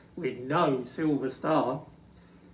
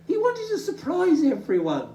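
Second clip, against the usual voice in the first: sniffing; 12 semitones higher, 3 dB louder than usual. Acted. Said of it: "he sniffed".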